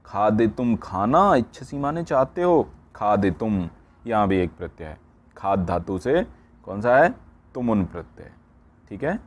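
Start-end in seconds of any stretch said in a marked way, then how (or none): none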